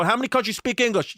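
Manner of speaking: high, mimicked rhetorical challenge; Brooklyn mother. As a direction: quiz them like a feisty Brooklyn lady. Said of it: "real deal Brooklyn lady smoking cigarettes".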